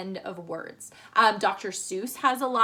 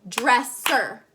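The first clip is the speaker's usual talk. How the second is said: dresser in two loud, separate beats; over-enunciate both halves of dresser; counted-out syllables; 5 dB louder than usual. Shown as a demonstration. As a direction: split dresser into DRESS, SER. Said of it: "just count syllables".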